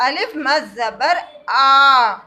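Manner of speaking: teacher stretching the vowel; long big aa. Shown as a demonstration. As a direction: pull آ out long for the class. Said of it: "بڑے آ کی آواز".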